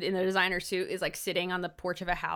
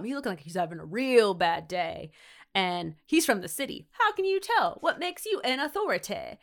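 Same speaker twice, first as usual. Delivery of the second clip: put-on awful Southern accent. Two old dudes chatting outside a grocery store, awful Southern accent. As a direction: play old Southern porch-sitters, badly. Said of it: "in awful Southern accents".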